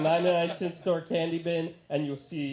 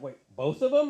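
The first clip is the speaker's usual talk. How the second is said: high, louder astonished check; incredulous question; 10 semitones higher, 5 dB louder than usual. Retold or imitated. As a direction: ask as if you can't believe it. Acts astonishment at friend's story.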